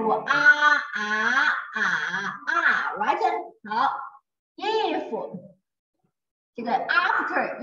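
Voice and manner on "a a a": "a" sustained in level, rising, dipping, falling tones; tone drill. Shown as a demonstration. Teacher showing Chinese tones, "a" drawn out in each. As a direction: run "a" through the Mandarin tones. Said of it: "Chinese have a".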